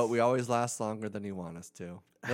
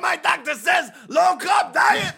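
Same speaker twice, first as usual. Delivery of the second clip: shouting Italian mobster, much higher; Italian mafioso accent; 13 dB louder, slower than usual. Pretend. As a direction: yell it like a mob guy. Italian mafioso bit continues.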